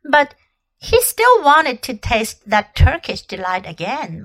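usual speaking voice

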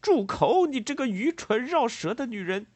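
high, loud, scornful order; acted angry insult; furious silencing. A narrator's reading. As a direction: shout them down, pitch soaring. Silencing a woman in acted quarrel.

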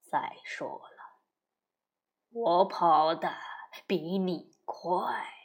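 low, whispered slow wolf; hushed wolf voice. Acted. Wolf replies quietly.

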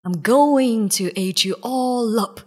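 big menacing lion voice; roaring lion. A narrator's reading. Lion threatens to eat the animals, roaring. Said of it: "roared the lion".

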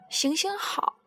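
soft whispered plea; pleading whisper. Acted. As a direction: whisper the favour as a sly beg. Crocodile begs the young man.

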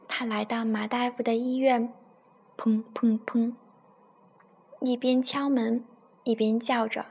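put-on storytelling voice for a child; story narration. A narrator's reading. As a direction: read it like a bedtime story to a little one. Mother reads bedtime story. (给孩子讲故事的语气)

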